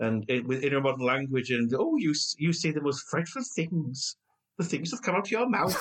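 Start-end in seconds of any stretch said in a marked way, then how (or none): 1.8-5.8 a mild plummy period accent, lightly put on; quoting a maid in prim posh tones; half-hearted posh English accent; posh Victorian maid accent. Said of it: "Apologies for the accent".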